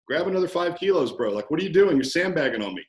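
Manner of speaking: punchy gym ribbing; friendly challenge. Acted. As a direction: call out a slacking regular in jest. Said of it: "challenging someone who's more advanced in a friendly manner".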